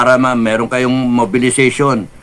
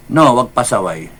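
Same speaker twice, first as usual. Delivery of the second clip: normal talking voice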